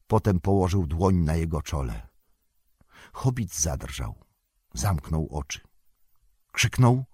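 ordinary delivery, nothing marked

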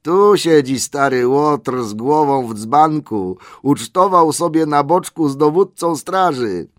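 high, loud, slow jeering voice; teasing elf pointing at the sleeper; mocking elf. A narrator's reading. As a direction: gloat over the drunk old rascal. Elf mocks the sleeping butler.

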